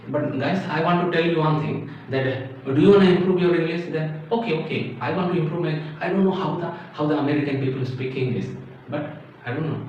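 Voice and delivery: put-on Indian English with hard T sounds; Indian accent. Teacher playing a learner who wants to improve, Indian accent. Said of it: "trying to talk with the Indian accent".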